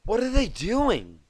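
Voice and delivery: panicked asking; frantic. Acted. Twilight frantically asks Applejack under fire. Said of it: "she asked frantically".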